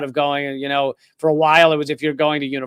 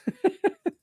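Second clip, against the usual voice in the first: short high laugh at a viewer's joke; a quick laugh that breaks up high; real laugh; 14 semitones higher, 6 dB quieter than usual. Real, not acted. Streamer to chat, after being teased about his accent: short high laugh.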